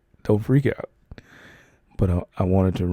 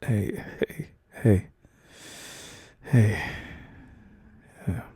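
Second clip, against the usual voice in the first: soothing whisper; slower than usual. Acted. Calming a distressed partner.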